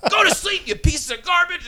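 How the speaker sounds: high-pitched mock shout; barking drill chief. Chief yelling at recruits every night, high mock bark.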